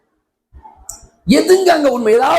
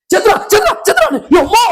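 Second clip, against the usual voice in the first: much higher mock wail; 9 semitones higher, faster than usual. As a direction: wail like a viewer at a death scene. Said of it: "அழுவ".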